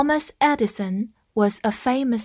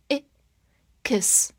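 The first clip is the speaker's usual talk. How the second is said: normal talking voice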